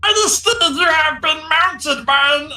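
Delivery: high, slow squeaky kobold; pompous little kobold, drawn out. Acted. Sir Dim addresses majesty.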